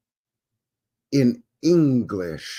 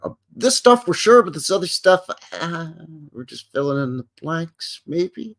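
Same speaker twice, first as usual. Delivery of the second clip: mocking the article; 6 semitones higher, 3 dB louder than usual. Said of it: "one of those articles where they're like".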